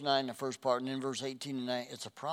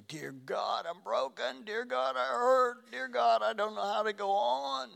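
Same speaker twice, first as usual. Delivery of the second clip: acted anguished wail, pitched very high and building louder; desperate cry; 10 semitones higher, 4 dB louder than usual. Praying to God when the wheels fall off, desperate wail. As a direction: cry out to heaven as if broken, swelling to a loud high plea.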